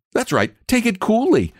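put-on high, excited young boy; a cheeky lad teasing; eager boy voice. A narrator's reading.

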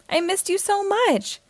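lifted high with feeling; emotional reunion. Acted. Boy hugging his mother on Christmas morning, high and heartfelt. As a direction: let the voice rise with relief. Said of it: "he said".

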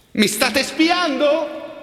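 high, slow, shouted into the rain; shouted question. Acted. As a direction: yell it after him. Repeated as a shout.

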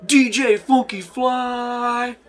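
high, drawn-out hype-man holler; DJ announcer shout. Acted. Game master announcing the party DJ, hyped-up holler. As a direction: stretch out the DJ's name, loud and high. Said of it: "and you hear".

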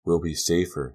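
plain reading voice

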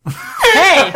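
sharp high indignant yell; indignant shout. Acted. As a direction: snap out an offended hey. Offended at question about milk.

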